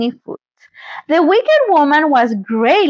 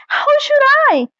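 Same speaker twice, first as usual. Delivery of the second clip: put-on high little girl question; child voice; 13 semitones higher than usual. A narrator's reading. Dorothy to the witch, high innocent child question. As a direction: ask it innocently.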